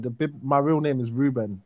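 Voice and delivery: ordinary talk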